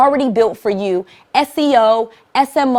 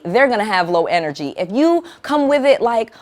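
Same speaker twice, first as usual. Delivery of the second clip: ordinary delivery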